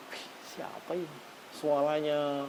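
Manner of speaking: a hushed, awed "who is this?"; hushed amazement. Retold or imitated. Worshipper amazed by the imam's recitation, hushed awe. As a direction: marvel under your breath at the voice.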